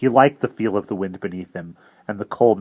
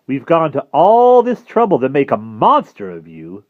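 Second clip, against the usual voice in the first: louder pushing challenge; a hint of impatient tempter; 5 semitones higher, 9 dB louder than usual. Jive goads Harvey to attack, impatient and louder. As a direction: goad him on.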